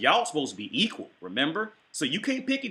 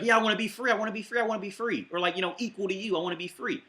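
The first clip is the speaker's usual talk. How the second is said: higher, whiny robot begging to be free; a robot son pleading with his dying father, higher and whiny; 5 semitones higher, faster than usual. Retold or imitated. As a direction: beg like a childish robot. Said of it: "he's like".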